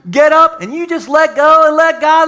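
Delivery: high, loud preacher cliche; mocking a preacher's catchphrase, hammed-up. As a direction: ham up a pulpit cliche. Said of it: "like that preacher said".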